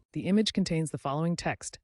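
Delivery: plain reading voice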